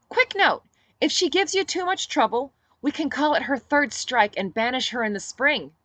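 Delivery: a sly, pleased heads-up; Olivia voice. Acted. Olivia's voice, cheerful aside.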